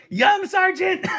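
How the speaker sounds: squeaky high yum, cracking up with laughter; laughing mock yum. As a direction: mimic your meek reply through giggles. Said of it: "I'm going".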